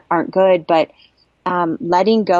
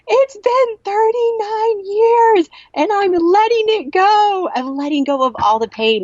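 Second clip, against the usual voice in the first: joyful screaming. Retold or imitated. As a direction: shout it out in sheer release, soaring high. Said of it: "I just kept screaming and, and joy".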